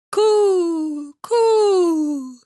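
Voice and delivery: high, loud drawn-out coo; pigeon cooing. Acted. Wood pigeons call out to Gerda, high and loud. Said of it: "said the wood pigeons".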